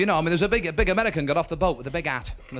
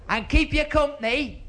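high, laboriously slow calling; shouting for deaf gran; 10 semitones higher, slower than usual. Acted. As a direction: stretch each word out for failing ears. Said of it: "my gran was a bit Mutt and Jeff".

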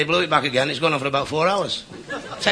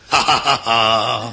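slow, deliberate ha-ha-ha-ha; mock laugh; 4 semitones lower, slower than usual. Valet scoffs at Beethoven, slow mock laugh. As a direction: laugh it out lower, note by note.

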